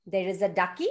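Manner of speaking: ordinary delivery, nothing marked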